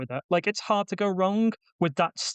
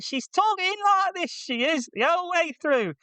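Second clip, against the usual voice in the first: much higher-pitched, exaggerated fake Yorkshire voice; mock Yorkshire accent; 14 semitones higher than usual. Film critic mimicking the lead actress, mock Yorkshire accent. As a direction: send up a badly faked Yorkshire accent. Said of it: "supposed to be from Yorkshire".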